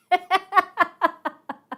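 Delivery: a high, loud burst of laughter. Real, not acted. Telling the class about the job offer, bursting out laughing.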